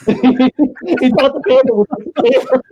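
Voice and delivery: high, slow laughing. Real, not acted. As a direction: laugh the words out. Laughing at his own story.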